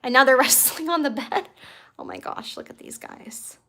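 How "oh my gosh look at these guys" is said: hushed whisper so the kittens aren't disturbed; soft unvoiced aside to the camera. Real, not acted. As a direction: breathe it out, barely voiced. Watching kittens wrestle, hushed.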